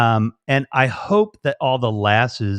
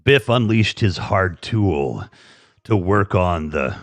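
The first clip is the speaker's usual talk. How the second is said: sexy bedtime story; 5 semitones lower, slower than usual. Pretend. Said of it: "sexy bedtime stories after eleven".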